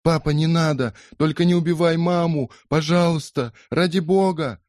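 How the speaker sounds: put-on high, loud pleading of a terrified child; desperate pleading. Polly begs her father not to kill her mother. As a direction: beg him to stop, near panic.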